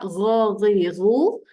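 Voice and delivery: "Gha, ghi, ghu" voiced from the top of the throat; voiced throaty rasp on "Gha, ghi, ghu"; phlegm-clearing gh. Shown as a demonstration. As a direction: gargle the "gh" as if bringing up phlegm. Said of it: "bunyi macam nak mengeluarkan kahak".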